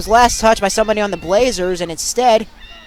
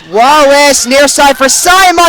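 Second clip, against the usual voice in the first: excited call; 3 semitones higher, 5 dB louder than usual. Real, not acted. Set point rally call.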